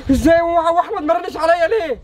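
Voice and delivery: high, breaking into tears; crying shock. Acted. Son learns his mother died, crying in shock. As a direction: cry out in disbelief at the news.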